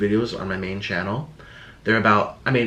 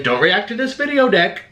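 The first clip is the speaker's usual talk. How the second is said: mock voice; 8 semitones higher, 3 dB louder than usual. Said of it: "I'm gonna be like".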